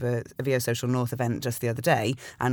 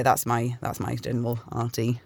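ordinary talk, nothing marked

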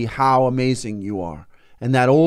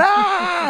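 frustrated cry. Retold or imitated. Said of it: "he's going".

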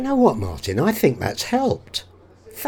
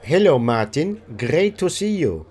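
put-on German accent; 2 semitones lower than usual. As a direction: play a German language student. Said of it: "Hans is German".

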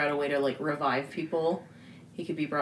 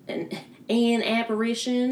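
southern accent; 6 semitones higher than usual. Real, not acted. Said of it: "Occasionally my southern accent comes out".

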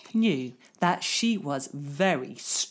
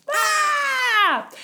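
high shrill bird screech; bird squawk; 4 dB louder than usual. Acted. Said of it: "he makes a noise like this".

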